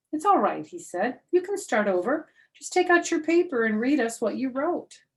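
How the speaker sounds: put-on a patient teacher coaxing a child.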